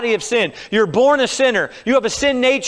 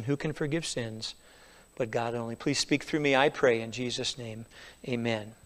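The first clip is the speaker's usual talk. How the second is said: earnest pulpit prayer; 4 semitones lower than usual. Acted. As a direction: pray humbly before the message. Leads a prayer.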